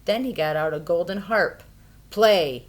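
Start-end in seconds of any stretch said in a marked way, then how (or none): 2.1-2.7 loud one-word order; roared command. A narrator's reading. Giant ordering his harp, loud roar. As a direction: bellow it at the harp. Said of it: "he roared".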